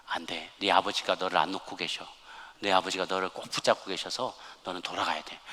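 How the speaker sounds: low, soft retold divine voice; quietly but firmly send the child back; Jesus' gentle refusal. Jesus refusing the boy in retold dialogue.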